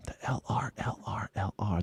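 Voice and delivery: whispered, unvoiced; hushed, voiceless repetition. Real, not acted. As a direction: breathe it as a whisper. Whispering the odd phrase.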